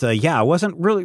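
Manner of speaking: ordinary talk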